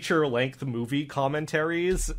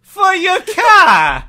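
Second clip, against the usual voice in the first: put-on loud, high Boston-accented cop, slowing at the end; comic cop outrage, drawn out; indignant cop; 15 dB louder than usual. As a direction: grudgingly concede in a raised cop voice.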